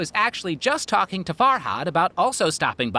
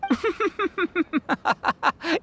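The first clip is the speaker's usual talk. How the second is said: teasing laugh; 12 semitones higher than usual. Acted. Teasing Farhad for being obvious.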